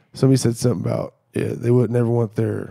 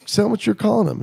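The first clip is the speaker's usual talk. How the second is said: higher, pointed challenge; daring challenge; 10 semitones higher than usual. Retold or imitated. Challenging the buddy to repeat the insult, higher and pointed. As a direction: dare him to say it to his face.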